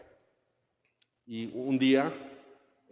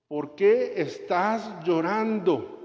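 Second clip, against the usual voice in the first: tender question; 7 semitones higher, 3 dB louder, much slower than usual. Acted. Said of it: "preguntas que hizo Jesús".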